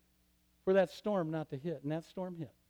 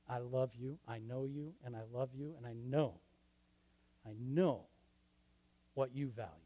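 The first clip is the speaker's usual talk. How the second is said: much softer, lower, intimate; tender voice of God; 8 semitones lower, 11 dB quieter than usual. Acted. God whispering love to the listener, very soft. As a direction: say it like a hushed blessing. God's tender still small voice.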